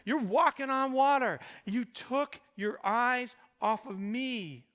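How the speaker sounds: loud, high voicing of Jesus's rebuke; fervent pulpit voice; preaching at full force. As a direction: thunder the question at the crowd.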